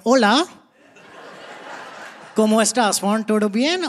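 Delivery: put-on Spanish lines in a light Indian accent. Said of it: "speaking Spanish in an Indian accent".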